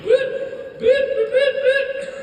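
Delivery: very high, loud siren whoops; piercing mock squad-car bleeps; imitated police siren. Speaker mimics cop car stopping runner, high siren whoops.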